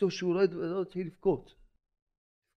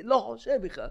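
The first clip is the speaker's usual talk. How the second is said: defiant officer; 5 semitones higher than usual. Retold or imitated. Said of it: "הוא אומר לי".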